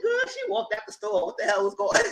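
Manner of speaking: put-on high, disbelieving girl-what voice; high, mock-baffled; incredulous falsetto. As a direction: squeak out the disbelief. Retelling own disbelief.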